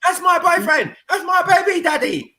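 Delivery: put-on high, brash chav girl; chavvy girl voice. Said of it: "acting chavvy girlfriend".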